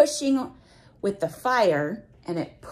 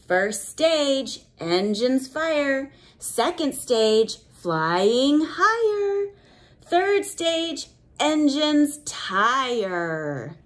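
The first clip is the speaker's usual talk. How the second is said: put-on reading to children; 4 semitones higher, much slower than usual. Reads book rhyme.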